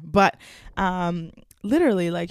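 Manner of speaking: ordinary delivery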